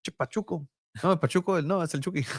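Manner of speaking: high put-on actor voice; Danny Trejo impression. Actor and coach muddle a player's name, put-on impression. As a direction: play the celebrity, pitched high. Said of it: "There's Danny Trejo interviewing fake Mikey Varas".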